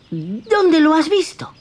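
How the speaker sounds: put-on high, childlike wooden puppet voice; Pinocchio's voice. Pinocchio coaxes the cricket toward the theatre, high childlike voice.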